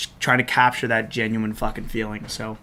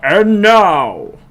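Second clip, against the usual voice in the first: announcer voice, higher and louder; fighting game announcer; 6 semitones higher, 8 dB louder than usual. Shown as a demonstration. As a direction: call it out to the arena. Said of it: "trying to do this announcer voice".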